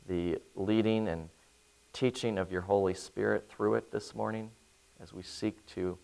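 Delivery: soft, hushed prayer voice; quiet earnest pastoral prayer. Acted. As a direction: pray quietly and humbly. Opening prayer before sermon.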